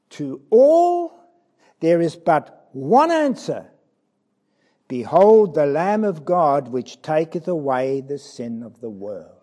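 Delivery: forceful proclamation. Acted. Reading the answer with force.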